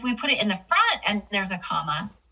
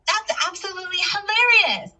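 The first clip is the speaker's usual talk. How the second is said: high-pitched emphatic exclamation; louder exclamation; 11 semitones higher, 4 dB louder than usual. Shown as a demonstration. Teacher demonstrating an exclamation mark, louder and emphatic. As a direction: exclaim it big, voice soaring on HILARIOUS. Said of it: "said more with louder intonation".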